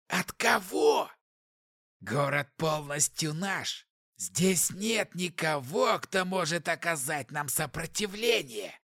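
slow, gloating demonic voice. Acted. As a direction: gloat slowly like a demon lord. Demon gloats about conquest.

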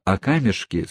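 plain reading voice

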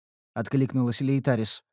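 ordinary delivery, nothing marked

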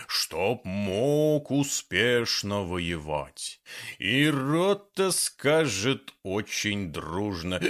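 slow, declaiming verse grandly; poem recitation. Acted. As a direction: recite it like a proud poet. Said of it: "начал декламировать".

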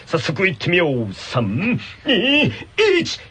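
upbeat announcer hyping the contest; game show host. Acted. Hosting a tongue-twister contest for the radio audience, as an upbeat announcer. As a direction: call it like a TV quiz host over music.

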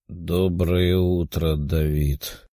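weak, slow, low mummy rasp; barely there, dusty and slow. A narrator's reading. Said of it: "раздался слабый голос мумии".

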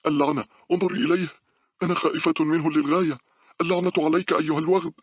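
very high, loud cursing shout; terrified scream. A narrator's reading. Girl screams at the face in the window, terrified. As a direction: scream curses to drive the fear away. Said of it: "صرخت مونيكا".

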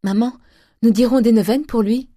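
loud, high eager appeal; eager plea. A narrator's reading. The daughter begging her mother for prayers, loud and eager. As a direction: urge the mother to pray.

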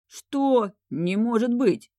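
a stunned king exclaims he can't believe it; изумлённый возглас. A narrator's reading. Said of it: "воскликнул Ильяс". (изумлённо, громко и медленно: «Не может быть!»)

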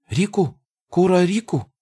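high goblin chatter; squeaky goblin asking something. Acted. Goblin gibberish, high pitch.

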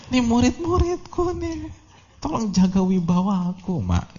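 high-pitched mock whine to mother; complaining son. Imagined son begs mother before his pupils, mock complaining. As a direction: plead in an exaggerated high voice. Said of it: "nggak bilang gitu".

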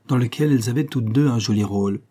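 ordinary delivery, nothing marked

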